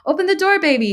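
high, loud shouted order; urgent shout. A narrator's reading. Father banging on daughter's door, urgent shout. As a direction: shout it through a shut door. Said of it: "he shouted".

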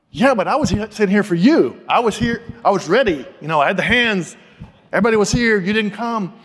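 indignant protest. Retold or imitated. Retold complaint to God.

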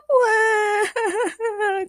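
high cartoonish bawl; long hammed-up sobbing wail; crying wail. A narrator's reading. Agnes grieves for James, wailing. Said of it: "cried Agnes Gertrude".

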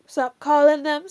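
ordinary talk

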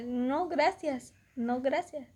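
slow refusal. Retold or imitated. Firmly refusing suitor, retold.

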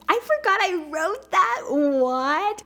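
surprised laugh. Real, not acted. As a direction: caught off guard by her own note. Surprised at her own notes.

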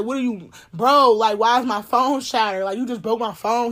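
loud, angry retold yell at him. To her ex about the cracked phone, loud angry yell. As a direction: demand answers loudly over the broken phone. Confronting ex over broken phone.

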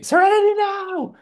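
very high, loud scream of "Serenity now!"; hammed-up scream quoting a sitcom; screaming Seinfeld quote. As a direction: shriek it like losing your mind.

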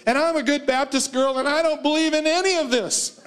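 high, light voice of a shaken Baptist woman; quoted testifying woman. Retold or imitated.

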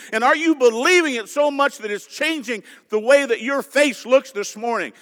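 ordinary talk

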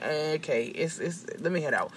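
soft, halting mock excuse; awkward excuse. Uncomfortable assistant slipping away, awkward quiet mumble. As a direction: stumble quietly toward the exit.

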